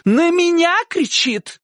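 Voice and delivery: high, drawn-out aggrieved cry; shouted complaint. A narrator's reading. Aunt complaining to uncle about dog-catcher, shouting. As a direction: wail the complaint high and slow. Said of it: "крикнула тётушка и показала на себя".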